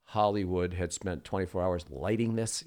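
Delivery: usual speaking voice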